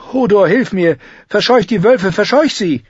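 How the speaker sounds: high, loud plea; desperate shout. Acted. Bran cries to Hodor to chase the wolves off, high and loud. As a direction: call for help in desperation.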